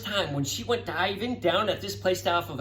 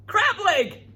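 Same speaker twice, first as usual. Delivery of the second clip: excited; 10 semitones higher, 5 dB louder than usual. Acted. Excited reveal in story.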